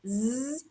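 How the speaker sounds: "Z" as a long zzz buzz, not the letter name; voiced z. Shown as a demonstration. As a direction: buzz "Z" by itself. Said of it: "voice sound of S".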